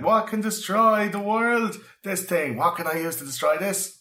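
high, slow, dramatic doomsayer voice; Al Bielek style. Retold or imitated. Podcast host mocking Al Bielek's style, high dramatic voice. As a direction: ham up a conspiracy theorist's grand question. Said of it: "Al Bielek style is like".

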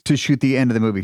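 ordinary delivery

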